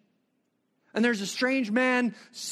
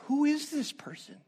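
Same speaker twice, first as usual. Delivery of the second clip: higher, hushed wonder; wondering aloud; 7 semitones higher than usual. Acted. Preacher voices the woman's wonder, higher and hushed. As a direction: ask in amazement, pitched up.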